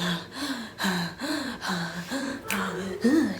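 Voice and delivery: slow, soft mock panting; laboured breaths, imitating a faked attack; faked heart episode. Friend pretending her heart is failing, mock gasping. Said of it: "pretend like she had these little heart things".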